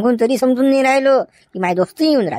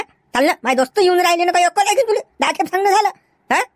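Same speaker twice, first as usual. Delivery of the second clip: scolding; 7 semitones higher than usual. Acted. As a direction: nag her to hurry, getting fed up. Husband scolds sulking wife.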